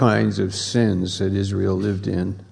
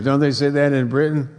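usual speaking voice

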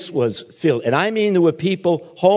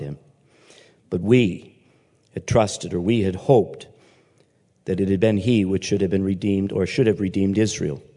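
measured, lower Bible reading; scripture reading; 6 semitones lower than usual. Acted. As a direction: read the passage to the congregation. Reads scripture aloud.

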